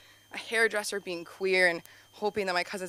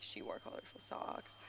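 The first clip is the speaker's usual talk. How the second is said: secretive schoolyard whisper; mock gossip whisper; 18 dB quieter than usual. Kids whispering about the teacher's socks, mock gossip whisper. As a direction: whisper it like kids gossiping behind her back.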